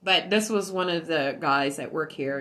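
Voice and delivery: ordinary delivery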